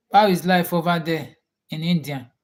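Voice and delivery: usual speaking voice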